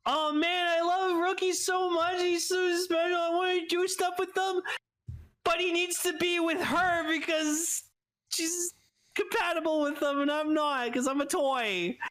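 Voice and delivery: squeaky, lovesick toy voice; high-pitched Idol voice. Retold or imitated. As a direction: gush like a lovestruck little toy. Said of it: "Idol being like".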